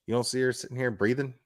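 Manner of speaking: ordinary talk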